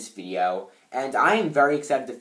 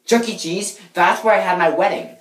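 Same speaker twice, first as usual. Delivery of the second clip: loud, high-pitched excitement; over-the-top thrilled; 5 semitones higher, 10 dB louder than usual. Acted. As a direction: gush it at full volume. Acted thrilled reaction in a take.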